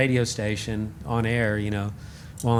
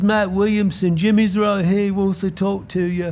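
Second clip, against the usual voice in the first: English assistant; 8 semitones higher, 3 dB louder than usual. Retold or imitated. Assistant voiced on phone.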